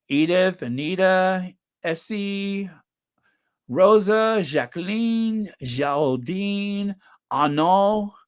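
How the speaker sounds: put-on names read in a light French accent; slow, playful French accent on names. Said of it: "I'm trying to use my French accent".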